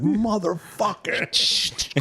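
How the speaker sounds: long drawn-out, high curse; high, very slow exclamation; mock outrage. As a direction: stretch the curse out in comic dismay.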